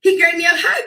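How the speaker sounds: ordinary talk, nothing marked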